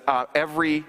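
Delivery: normal talking voice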